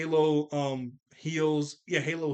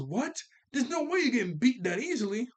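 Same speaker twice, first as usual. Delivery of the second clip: angry Granny; 8 semitones higher than usual. Retold or imitated. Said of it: "She goes".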